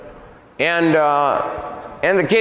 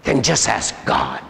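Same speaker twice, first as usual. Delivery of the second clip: slow demon voice, slightly lowered; demon's challenge; 3 semitones lower than usual. Retold or imitated. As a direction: imitate the demon's unhurried dare. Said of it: "That's what he said to me".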